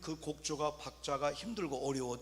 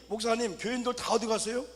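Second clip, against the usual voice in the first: higher, louder alarmed call to the pastor; alarmed question; 6 semitones higher, 5 dB louder than usual. Retold or imitated. Church member asks the pastor, alarmed. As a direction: sound startled that everyone vanished.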